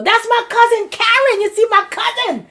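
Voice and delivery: high, loud burst of delight; thrilled to spot a cousin, voice leaping up; excited. Real, not acted. Spots cousin among live viewers.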